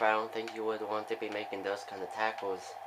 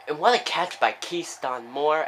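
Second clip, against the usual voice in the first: louder, higher call of a big catch; excited play-by-play; 4 semitones higher, 7 dB louder than usual. Real, not acted.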